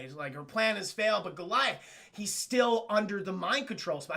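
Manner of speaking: ordinary talk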